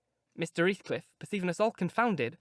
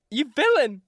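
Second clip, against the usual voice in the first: higher, loud furious cry; crying out in rage; 7 semitones higher, 8 dB louder than usual. Acted. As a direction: hurl it at him, outraged. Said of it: "I began to cry".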